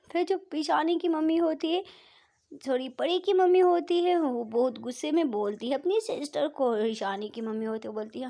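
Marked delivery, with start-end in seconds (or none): none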